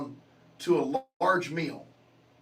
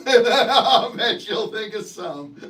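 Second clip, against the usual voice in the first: slow, louder, laughing through the words; 3 semitones higher, 5 dB louder, much slower than usual. Real, not acted. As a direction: repeat it while cracking up.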